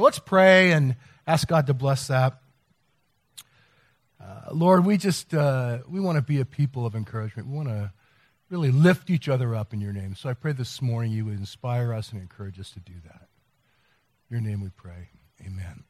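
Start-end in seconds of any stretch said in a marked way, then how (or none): 4.2-15.8 soft, lower prayer voice, sinking into the amen; quiet prayer. Acted. Pastor leading the congregation in prayer, soft and reverent. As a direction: pray gently, letting the voice drop.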